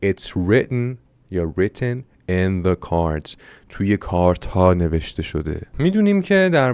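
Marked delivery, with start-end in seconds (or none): 0.0-3.4 title read out very slowly. Shown as a demonstration. Teacher reads story title slowly to class. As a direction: read "written in the cards" slowly. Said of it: "اسم داستان چیه؟".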